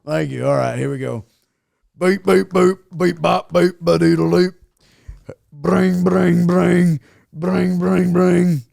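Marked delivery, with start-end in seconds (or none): 2.0-4.5 playful spoken beeps and boops; sing-song dialing noises; mouth dial tones. Pretend. Dialing phone with mouth sounds. 5.5-8.6 spoken ring-ring noise; mock ringing phone; phone ring imitation.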